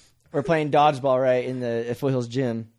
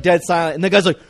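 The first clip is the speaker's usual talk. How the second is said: ordinary talk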